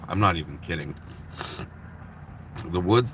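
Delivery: ordinary talk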